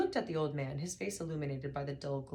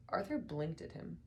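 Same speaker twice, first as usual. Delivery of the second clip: usual speaking voice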